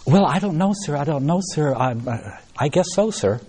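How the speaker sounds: imitated girl, unsure and eager to please; flustered young girl. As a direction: stammer politely to an elder. Girl answers the old gentleman, flustered.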